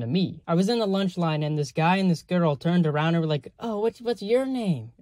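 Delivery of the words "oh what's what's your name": high, slow fake-sweet condescension; patronizing pity friend. Guy and girl in lunch line, mock patronizing. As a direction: talk down to him, imitating pity. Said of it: "like, a patronizing, like, pity friend".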